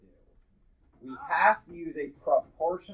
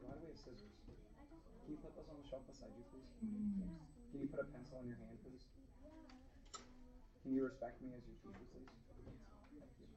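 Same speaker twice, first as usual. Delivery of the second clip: quiet; 22 dB quieter, faster than usual. Real, not acted. Teacher quietly corrects a student.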